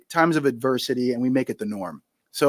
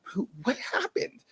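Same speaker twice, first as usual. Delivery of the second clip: mock disbelief; 6 semitones higher than usual. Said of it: "and we're like".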